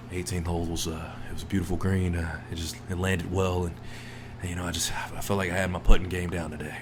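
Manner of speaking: low, soft, mumbling mock Tiger Woods press answer; bland golfer. Mocking a golfer's flat post-round press talk, low and quiet. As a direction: drop low and murmur like a dull champion at the mic.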